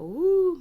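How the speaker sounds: high, loud whistling hoo; mock teapot squeal; teapot whistle. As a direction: imitate a shrill kettle. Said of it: "you hear the teapot go".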